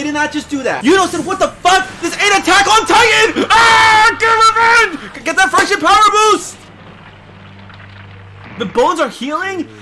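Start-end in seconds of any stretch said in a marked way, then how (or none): 0.8-6.6 high, loud outburst at the screen; frantic yelling. Real, not acted. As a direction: urge the hero on at a shout. Yelling at the fight.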